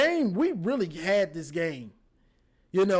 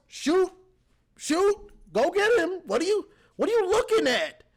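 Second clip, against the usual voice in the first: much higher, urgent calls at a defender; yelling at the screen; 8 semitones higher than usual. Real, not acted. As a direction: shout at him like he can hear you. Yelling at player on replay.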